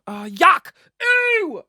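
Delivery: loud recoil, leaping high on the ooh; disgusted. Real, not acted. Comedian recoiling from calling himself a celebrity, disgusted. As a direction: yelp in revulsion.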